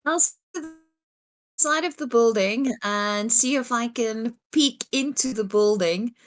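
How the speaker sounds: ordinary delivery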